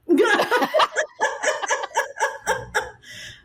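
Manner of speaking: a high, bursting embarrassed laugh. Real, not acted. Guest asked for her best British accent, laughing it off.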